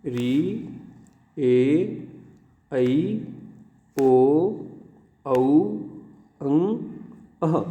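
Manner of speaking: each vowel sounded out alone, with a pause after it; drawn-out letters, one at a time; vowel recitation. Shown as a demonstration. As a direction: recite the vowel chart slowly for learners. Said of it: "अंतिम बार इसके उच्चारण करके".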